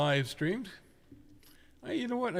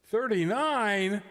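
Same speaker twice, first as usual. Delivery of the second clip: surprised; 6 semitones higher, 4 dB louder, much slower than usual. Real, not acted. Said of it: "Would you care to repeat that?".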